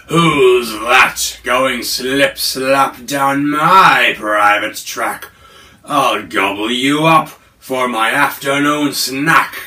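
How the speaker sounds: loud, slow, menacing growl of a monster; monster's threat. Acted. The Yowie menacing Little Gruff from his ditch, loud and slow. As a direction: loom over the little one and growl.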